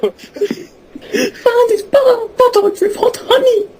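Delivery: high, slow, crying with laughter; can barely get the words out for laughing; breaking up laughing mid-line. Real, not acted.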